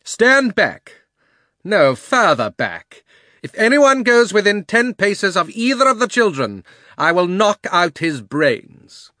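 high, loud imperious threat; witch's commanding cry. A narrator's reading. Witch warning everyone away from the children, loud threatening command. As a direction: ring out each order with menace. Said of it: "cried the witch".

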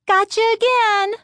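put-on high teasing girl voice; triumphant bratty kid; gleeful little sister. A narrator's reading. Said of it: "she declared".

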